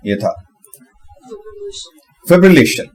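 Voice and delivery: ordinary talk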